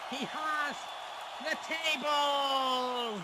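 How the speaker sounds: high, drawn-out play-by-play call; big slow hype call; excited commentator shout. Real, not acted. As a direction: stretch out the last word in loud excitement. Commentator hypes the tables appearing.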